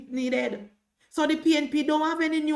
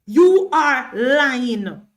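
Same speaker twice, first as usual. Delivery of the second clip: much louder, dragging every word very slowly; loud drawn-out accusation; 8 dB louder, much slower than usual. Real, not acted. To Sheldon, final loud drawn-out accusation of lying. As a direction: bellow it out, stretched and slow.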